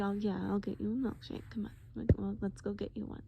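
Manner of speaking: soft, half-whispered comforting; caring boyfriend, coaxing. Acted. Boyfriend offering droopy-eyed partner a milkshake, tenderly coaxing. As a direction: coax someone groggy, gently and patiently.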